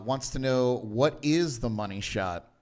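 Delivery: ordinary talk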